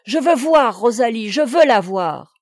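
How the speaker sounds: loud, insistent near-shout; almost cry out her demand; demanding to see the maid, close to shouting. A narrator's reading. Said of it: "criant presque".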